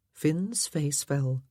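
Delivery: plain reading voice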